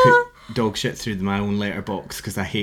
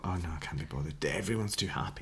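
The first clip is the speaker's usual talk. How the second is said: muttered aside; 7 semitones lower, 16 dB quieter, faster than usual. Retold or imitated. Quoting own thought, quiet fast.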